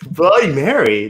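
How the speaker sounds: ordinary delivery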